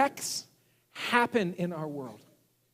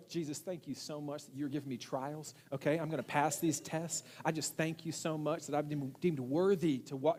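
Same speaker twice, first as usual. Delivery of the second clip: soft, overly saintly thanks; hushed mock-holy gratitude; mock pious prayer; 5 semitones lower, 9 dB quieter than usual. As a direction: play the perfect grateful believer, softer. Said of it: "No, I did not".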